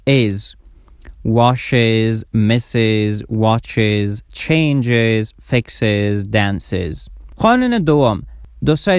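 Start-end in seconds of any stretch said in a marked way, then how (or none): 0.1-7.1 every word from "washes" to "dances" ends in a full iz; correct extra syllable. Shown as a demonstration. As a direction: list "washes" to "dances", each with two clear syllables. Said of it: "باید شما یک هجا بیشتر تلفظ بکنین". (از «washes» تا «dances» با هجای ایز)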